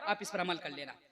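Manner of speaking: normal talking voice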